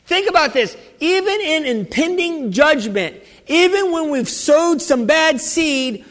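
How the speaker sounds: impassioned preacher; fervent preaching. Acted. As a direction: drive the point home to the church. Preacher repeats for emphasis.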